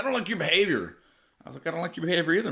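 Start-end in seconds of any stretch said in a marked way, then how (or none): 0.0-1.0 higher, louder prissy cop scolding; mock the officer's stiff reprimand; imitating the cop. Mock cop scolding the narrator over his parking, higher and louder. 1.7-2.5 sarcastic parrot of the cop; mocking echo. Narrator mocking the cop's line back at him, higher and sarcastic.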